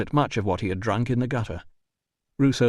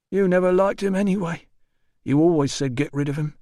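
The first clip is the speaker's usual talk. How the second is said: put-on higher, slurred drunk whine; drunk porter whining; 8 semitones higher than usual.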